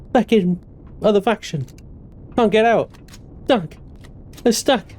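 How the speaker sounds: panicked. Real, not acted. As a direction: ask frantically how to escape. Stuck, panicked questions.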